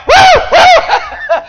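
very high, loud whoops breaking into laughter; girl's whoops, laughing. Retold or imitated. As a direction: imitate her joyful shouting through a laugh. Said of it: "watching her".

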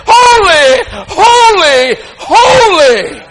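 high, loud triple cry of holy; soaring shouted holy, holy, holy; seraphim's cry. Acted. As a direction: cry it out like angels above the throne. Voicing seraphim crying out.

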